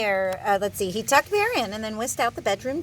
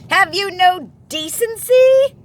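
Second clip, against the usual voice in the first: loud, high, slow bellow; 11 semitones higher, 14 dB louder, slower than usual. Acted. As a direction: roar it in full outrage. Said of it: "bellowed Bear".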